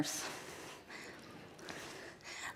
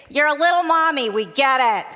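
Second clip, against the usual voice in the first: put-on higher and louder, a kids' chorus rolling their eyes; bored kids mocking; 6 semitones higher, 7 dB louder than usual. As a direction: sing it back like bored kindergartners, a little loud. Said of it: "the kids would say".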